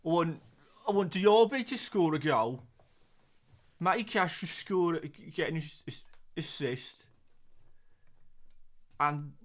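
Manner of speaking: halting, hammed-up Brummie attempt; Birmingham accent. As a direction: have a shaky go at a Birmingham football fan. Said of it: "Try and do a little accent".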